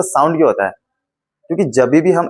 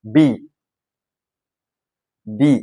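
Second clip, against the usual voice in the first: ordinary talk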